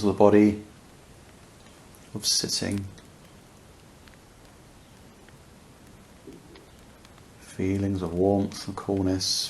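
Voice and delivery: unhurried meditation voice with long pauses; guided meditation. Real, not acted. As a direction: guide listeners gently into awareness, leaving space between phrases.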